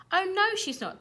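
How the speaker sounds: high, loud defiant yell. A narrator's reading. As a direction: shout back at the dragon. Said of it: "yelled Gladabout".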